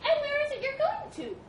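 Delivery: high-pitched, curious mouse voice; little mouse. Acted. Mouse asking where the elephant is going, high and squeaky. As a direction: pipe up as a tiny mouse.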